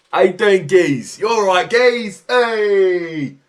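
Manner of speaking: very loud, high mock lad greeting; English yob. Streamer mimicking lads hailing their geezers, mock yobbish shout.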